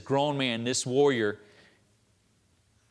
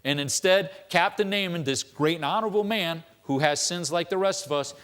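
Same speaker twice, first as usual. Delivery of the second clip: usual speaking voice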